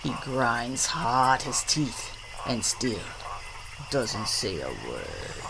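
soft, very low close; hushed narration. A narrator's reading. Narrator on gator grinding his teeth, hushed. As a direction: sink down into a tense hush.

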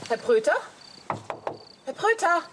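calling a name toward a closed shop, rising. Acted. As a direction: call out to see if someone's inside.

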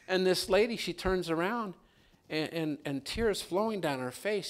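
normal talking voice

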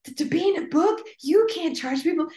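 gremlin voice. Retold or imitated. Coach voicing the inner gremlin, high and loud. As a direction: let the gremlin squeal its objection. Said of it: "little gremlin brain".

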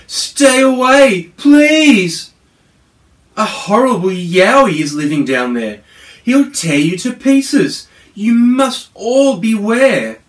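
high, loud, pleading yell; yelled warning. Acted. Koala begging the family to keep away, yelled high and loud. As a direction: shout the danger down from the treetops. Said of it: "a koala yelled down from the trees".

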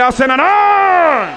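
high, loud, slow drawn-out আছে না নাই; rousing shout. Real, not acted. Preacher demanding the crowd shout back, slow high shout. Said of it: "চিৎকার মেরে বলতে হবে".

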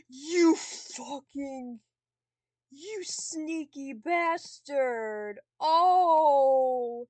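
slow, drawn-out cursing that builds to a loud cry; outraged cursing. Real, not acted. Player cursing a sneaky game opponent, outraged. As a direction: stretch each word in outrage, then erupt.

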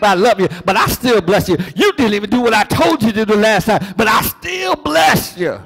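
God voiced, building to a shout; building preached shout. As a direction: preach God's words up to a shout.

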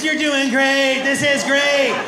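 high, drawn-out mock encouragement; bad friend's cheering. Acting a bad friend cheering on a drunk, mock-encouraging. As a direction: gush false support, stretching the words. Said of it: "A bad friend is".

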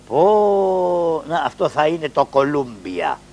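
usual speaking voice

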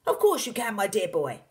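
loud, high encouragement; excited cry. A narrator's reading. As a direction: burst in to cheer the boy on. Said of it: "cried Mister Cranky".

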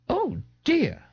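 high, affected camp exclamation; a dandy tittering at a sight; high mocking dandy. Amused young man mocks Ignatius, high and affected.

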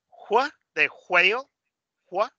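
JU slowly isolated, before and after JUEGO; sound demo. Shown as a demonstration. Teacher shows the sound of juego, slow demonstration of JU. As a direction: model JU slowly, with pauses. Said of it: "Cada uno de esos sonidos".

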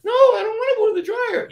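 very high, loud little clay-figure voice; Mister Bill voice. Retold or imitated. Guest imitates Mister Bill greeting his tormentor, very high and loud. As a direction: squeak it out like the helpless clay man. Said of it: "he talked like that voice".

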